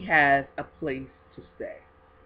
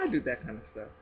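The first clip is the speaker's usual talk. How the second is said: ordinary talk, nothing marked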